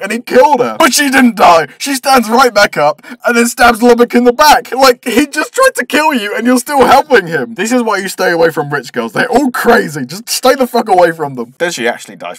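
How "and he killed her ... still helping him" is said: high, loud incredulous telling; indignant recap. Acted. Reviewer faults the girl for helping her attacker, indignant. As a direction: protest the character's choice in exasperation.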